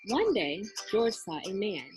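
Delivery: plain reading voice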